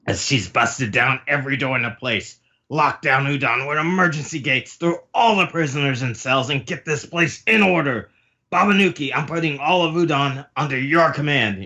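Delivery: high-pitched orders building in intensity; commanding urgency. Acted. Queen puts Babanuki in command of Udon, high urgent orders.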